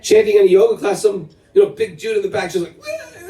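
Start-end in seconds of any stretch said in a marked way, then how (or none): 2.8-3.3 high, soft imitated sob; a hint of a big man crying; mock wail. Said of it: "just starts crying".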